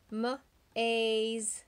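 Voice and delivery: M-Aze with the long A drawn out; stretched A sound. Shown as a demonstration. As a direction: drag the A in M-Aze for the class. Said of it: "Do you hear the A sound?".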